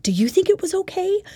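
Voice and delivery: high, pleading worried question; anxious asking. Retold or imitated. Asking people around you, high and anxious.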